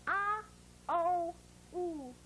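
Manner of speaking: "ah", "oh", "ooh", each held separately; three distinct vowels with pauses between; phonogram sounds. Shown as a demonstration. Teacher modelling the sounds of O, separated vowels. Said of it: "This phonogram says".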